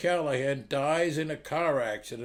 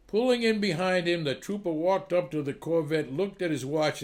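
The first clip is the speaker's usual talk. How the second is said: usual speaking voice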